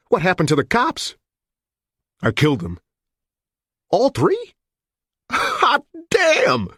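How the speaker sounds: gleeful disbelief; excited newsman. A narrator's reading.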